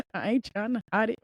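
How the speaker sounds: normal talking voice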